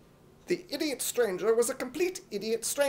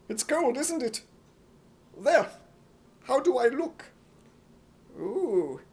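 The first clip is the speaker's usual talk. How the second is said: ordinary delivery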